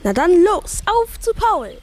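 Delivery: high, eager and loud at first; child rushing off to find a lost friend, excited. Acted. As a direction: jump at the chance to find Paul.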